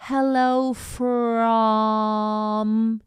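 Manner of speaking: hello from drawn out very slowly; very slow demo. Shown as a demonstration. Teacher stretches the phrase slowly. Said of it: "British or American".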